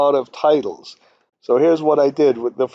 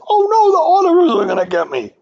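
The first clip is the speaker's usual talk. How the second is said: much higher, mock-panicked whine; 14 semitones higher, 4 dB louder, faster than usual. Teacher mocks modelers scared of the auditors, high mock panic. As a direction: imitate a worried modeler fearing auditors. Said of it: "Don't get obsessed like I have been".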